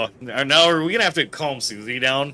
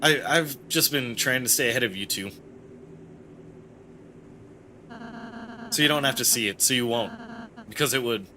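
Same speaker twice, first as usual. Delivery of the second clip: subdued, slightly lowered gentle character; Ralsei admitting; 8 dB quieter, faster than usual. Acted. Ralsei explains shielding the two friends, subdued and hesitant. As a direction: admit it reluctantly and trail away.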